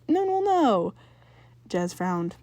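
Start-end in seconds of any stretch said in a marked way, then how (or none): 0.1-0.9 big sister objecting. Acted. Jazz objects.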